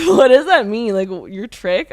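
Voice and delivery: a retold baffled outburst, half-mocking herself; retold confused disbelief. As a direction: re-enact being thrown by the slang word, loudly. Confused by the Miami slang.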